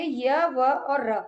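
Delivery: each letter named slowly and apart; slow letter listing. Shown as a demonstration. Teacher listing letters slowly for students. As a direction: spell out य, व, र one by one, unhurried. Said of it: "इन तीन व्यंजनों".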